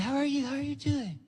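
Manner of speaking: Michael welcoming guests in a high hush; Michael greeting. Retold or imitated. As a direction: greet them in a light falsetto. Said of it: "Michael be saying".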